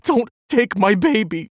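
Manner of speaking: high, loud, tearful begging; sobbing plea. A narrator's reading. Alice begging the doctor for her baby, loud high sob. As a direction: cry it out, pleading through tears. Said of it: "she sobbed".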